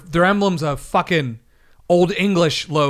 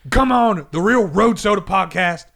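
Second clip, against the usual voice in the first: higher, louder hammed-up wrestling promo; wrestler promo voice; 6 semitones higher, 4 dB louder than usual. Cutting a wrestler promo at the rival podcast. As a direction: call him out like a pro wrestler. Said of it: "Randy Savage, Hulk Hogan voices".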